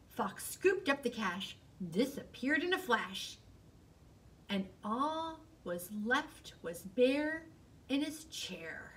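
ordinary delivery

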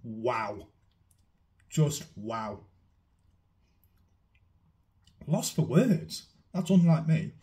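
savouring a bite; chewing while talking. Real, not acted. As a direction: mouth partly full. Seen 2: Bit food at -12; jaw and lips chew between words, snack in hand.

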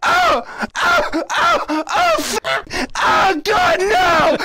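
trapped king panicking in the dungeon, rising yells; panicked cries. Acted. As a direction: yelp as if something is coming for you. Locked in with the dungeon monster.

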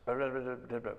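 ordinary talk, nothing marked

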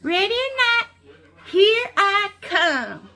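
hide-and-seek count. Real, not acted. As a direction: count out loud for hiders in another spot.

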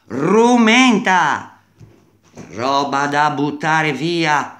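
loud, slow, drawn-out scornful cry; contemptuous shout. Real, not acted. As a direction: bellow it out in disgust. Sbotta di disprezzo per l'app. (grido sprezzante e lento)

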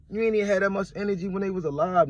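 heated, high and fast rush; worked-up, rapid-fire reproach; indignant. Real, not acted. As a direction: call them out loudly, pitch jumping up. Indignant challenge to those dissing dead.

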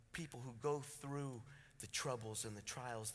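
quiet sermon. Acted. Preacher speaks softly to the church about the lows.

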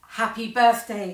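loud birthday greeting, called out together; cried out. Acted. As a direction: cheer it out like four happy grandparents. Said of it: "cried the four old grandparents".

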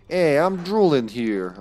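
slow, hammed-up New York Italian accent. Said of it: "I don't know why I went full, uh, New York Italian there".

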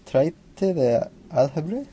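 "Traité de Algebre" in a Spanish accent. Shown as a demonstration. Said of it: "I can only say it in a Spanish accent".

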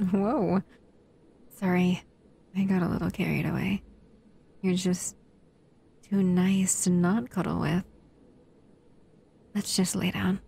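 gentle intimate roleplay voice, close to the mic. Acted.

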